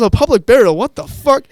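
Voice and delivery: high, loud mock outrage; outraged big boss. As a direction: play a crime boss blowing up in disbelief. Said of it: "Big boss goes".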